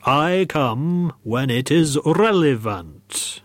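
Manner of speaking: slow, drawn-out spectral reply; ghostly voice. Acted. Ghost corrects the gaffer about timing, slow ghostly voice. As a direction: stretch the words like a solemn spirit. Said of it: "said a firm voice".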